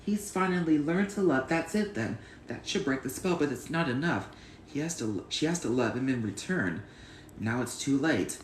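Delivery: child acting out all the film's characters by heart; a child's dramatic movie recitation; reciting a film scene. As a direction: play every character from memory.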